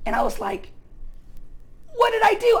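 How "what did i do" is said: pitched way up and louder, protesting; a kid wronged, squeaking high; upset disbelief. Retold or imitated. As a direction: cry out in shocked innocence. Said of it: "I was so upset".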